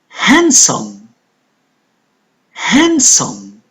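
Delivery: HANDSOME said as "han-sum", D silent. Shown as a demonstration. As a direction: model HANDSOME slowly with no D sound. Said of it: "D is the silent letter".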